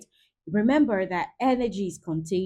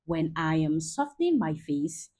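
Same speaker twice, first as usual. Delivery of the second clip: ordinary talk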